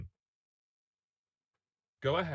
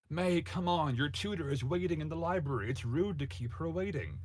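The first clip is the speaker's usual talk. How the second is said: firm, motherly, a bit louder; stern but loving; 7 semitones higher, 4 dB louder than usual. Acted. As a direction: call her daughter in, stern yet kind. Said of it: "in her usual stern but loving voice".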